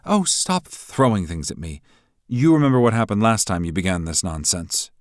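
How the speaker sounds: lightly voiced dismissive rival. A narrator's reading. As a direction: brush him off.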